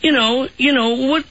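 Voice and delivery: high whiny woman voice; Amber Fry voice. Acted. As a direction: whine it out like a clingy girlfriend. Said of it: "way too good at the whiny woman voice".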